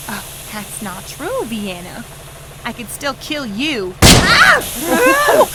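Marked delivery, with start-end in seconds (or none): 4.0-5.5 a high squealing shriek like an oink. Acted. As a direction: yelp so it comes out as a pig's oink. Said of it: "That sounded like an oink".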